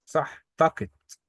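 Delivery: تاكت said slowly with a hard k; تاكت, k not kh, drawn out; German word modelled. Shown as a demonstration. Teacher correcting the student, slow careful تاكت. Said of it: "لا، تاخت".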